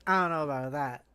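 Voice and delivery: ordinary talk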